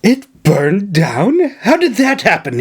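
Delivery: aghast at the news; gasping shock. Acted. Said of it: "he gasped".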